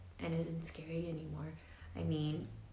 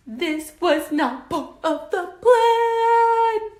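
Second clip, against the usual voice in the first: slow, much higher, louder sing-song wail; panicked wail; 14 semitones higher, 17 dB louder, much slower than usual. Acted. Squirrel panicking as the kit falls, loud high wail. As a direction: wail it like the plan just fell apart.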